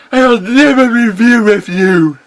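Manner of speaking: high, loud, stubborn refusal; defiant pony hero. Acted. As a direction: refuse him like a brave pony.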